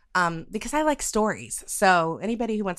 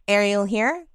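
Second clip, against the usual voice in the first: usual speaking voice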